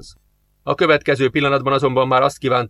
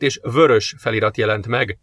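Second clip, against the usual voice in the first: plain reading voice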